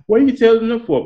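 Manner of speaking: put-on sharp, high annoyed snap at the child; annoyed parent. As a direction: play the irritated parent catching them out. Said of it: "some parents get mad, like".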